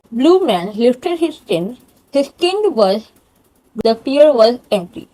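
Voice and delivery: slow reading around a pencil; pencil in mouth. Shown as a demonstration. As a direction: read aloud with a pencil held in the mouth. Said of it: "जैसे कि एक pencil लो". (मुँह में pencil दबाकर पढ़ना)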